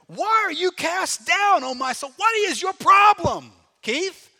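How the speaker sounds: high, loud, slow scolding; shouting at himself. Shown as a demonstration. Preacher yelling at himself like a drill sergeant, loud. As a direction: yell it at your own soul. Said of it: "You might need to shout at yourself".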